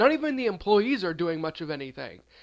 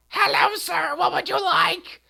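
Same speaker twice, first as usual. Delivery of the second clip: high, loud, grating witchy screech; painfully annoying old hag, shrill and drawn out; annoying witch woman; 11 semitones higher, 5 dB louder, slower than usual. Acted. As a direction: greet a customer in an ear-grating, high-pitched voice. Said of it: "her voice is really annoying".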